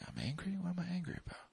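soft, high-pitched disbelief; quiet mock-baffled denial; feigned innocence. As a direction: protest meekly in a small high voice. Acting his denial of being angry.

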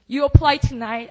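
emphatic quote. Retold or imitated. Quoting the pastor urging her to apply, loud. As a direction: insist he apply right now. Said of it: "Do you remember saying that?".

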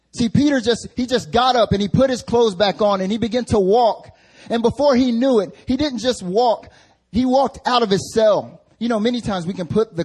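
high, loud preaching; impassioned preaching. Real, not acted. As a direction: drive the story home from the pulpit. Preaching at full force.

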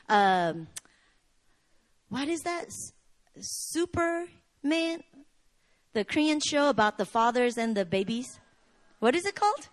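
usual speaking voice

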